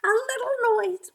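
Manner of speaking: very high, small rabbit voice; a tiny bunny with an idea; whispering rabbit. A narrator's reading. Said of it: "whispered the rabbit".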